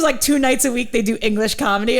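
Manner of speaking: normal talking voice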